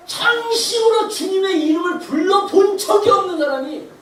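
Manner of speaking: high-pitched, slow preaching appeal; urgent exhortation. Real, not acted. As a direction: urge the congregation with effort in every phrase. Exhorting the congregation. (간절하게 촉구하는 설교)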